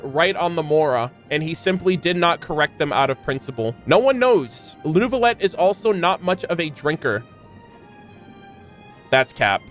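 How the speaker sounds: usual speaking voice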